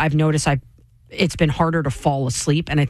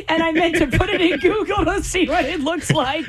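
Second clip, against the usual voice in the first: laughing; 12 semitones higher than usual. Real, not acted. Laughing at own text mistake.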